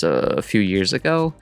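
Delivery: ordinary talk, nothing marked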